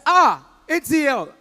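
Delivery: ordinary delivery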